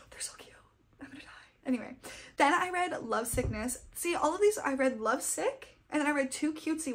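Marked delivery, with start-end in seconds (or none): 0.1-1.3 softer whispered gush; hushed fangirl squeal held in. Real, not acted. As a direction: whisper it like it's too cute to bear. Gushing over favorite characters.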